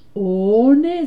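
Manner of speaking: loud, drawn-out refusal; firm refusal. Acted. Bert refuses to help search. (nadrukkelijke, luide weigering)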